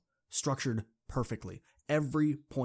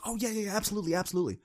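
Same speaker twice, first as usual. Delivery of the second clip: eager agreement; 10 semitones higher, much faster than usual. Retold or imitated.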